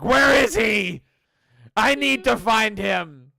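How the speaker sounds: high, loud desperate yell; frantic shout. Pretend. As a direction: shout it like you're searching frantically. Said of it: "and being like".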